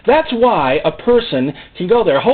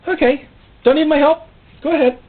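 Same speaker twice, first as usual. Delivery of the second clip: much higher, airy brush-off; mock-breezy God; 12 semitones higher, 3 dB louder than usual. God letting the stubborn believer go, in a high breezy voice. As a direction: shrug it off lightly. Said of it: "He says".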